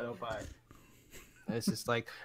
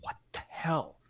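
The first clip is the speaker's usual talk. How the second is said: mock disbelief; 2 semitones higher, 4 dB quieter than usual. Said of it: "It made Panda be like".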